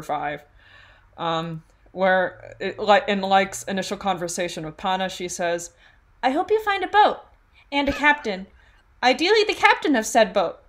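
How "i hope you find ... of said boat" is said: high-pitched character voice; quoted book line, pitched well up; Alia voice. Acted. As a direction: read it in a put-on high voice. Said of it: "read them in my Alia voice".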